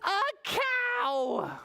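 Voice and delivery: very high, drawn-out astonishment; amazed reveal. A narrator's reading. Reader showing children what vet pulled out, amazed. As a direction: announce an impossible find at high pitch.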